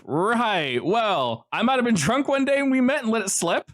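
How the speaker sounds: high, sheepish covering excuse; Joe's excuse. Acted. Joe's excuse, higher louder.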